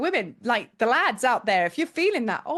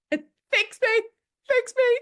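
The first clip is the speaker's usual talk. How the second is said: much higher, loud mock begging; desperate plea; 12 semitones higher, 4 dB louder, slower than usual. A frantic visitor begging the receptionist, mock desperate. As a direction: beg to be fixed, hammed-up. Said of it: "like a scene out of Pretty Woman".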